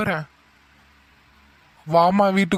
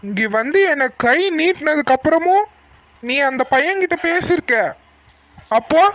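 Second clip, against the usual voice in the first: angry demand; 8 semitones higher than usual. Acted. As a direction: push her hard to confess. Father demands the truth, furious. (கோபத்துடன் உண்மையைக் கேட்பது)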